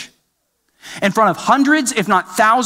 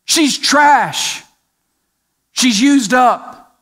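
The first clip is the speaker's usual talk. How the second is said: crowd jeering; 6 semitones higher, slower than usual. Retold or imitated. Crowd at the auction jeering at Gomer, a raised mock yell. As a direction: jeer at her from the crowd. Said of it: "people are screaming out".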